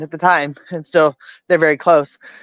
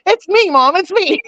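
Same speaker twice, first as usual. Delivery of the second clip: daughter's voice; 12 semitones higher than usual. Retold or imitated. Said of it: "And she goes".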